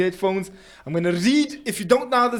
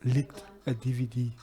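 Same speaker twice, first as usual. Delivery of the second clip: whispering; 6 semitones lower, 8 dB quieter than usual. Shown as a demonstration. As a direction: whisper it again into the mic. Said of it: "I'm going to whisper it into the mic".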